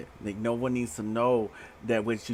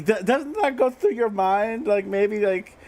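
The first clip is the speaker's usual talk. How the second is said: much higher, louder mock voice of the man she's dating; high mocking suitor voice; 11 semitones higher, 5 dB louder, slower than usual. Reader voicing the suitor hearing she was a hoe, high and mocking. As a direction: squeak it out like a shocked boyfriend.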